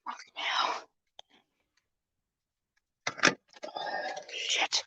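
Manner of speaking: breathless whispered swearing under pain; real whispered cursing, in pain; whispered curses. Real, not acted. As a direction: hiss the curses so no one hears.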